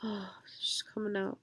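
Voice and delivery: whisper. Real, not acted.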